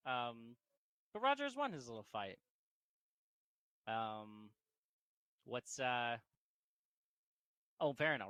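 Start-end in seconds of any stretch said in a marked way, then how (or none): none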